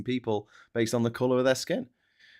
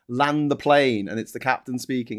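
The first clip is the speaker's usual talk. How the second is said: normal talking voice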